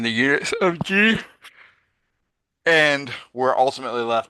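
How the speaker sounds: words stretched by a yawn. Real, not acted. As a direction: talk through a yawn. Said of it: "Sorry about my yawning".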